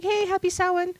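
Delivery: high-pitched imitation of cheery passers-by; imitated sing-out hello; mock excited greeting. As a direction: retell their greeting way up high.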